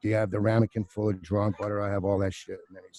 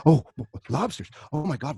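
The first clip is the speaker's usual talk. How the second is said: mock kitchen fluster; 5 semitones higher than usual. Kitchen staff fuss over a lobster order.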